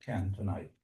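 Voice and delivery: ordinary delivery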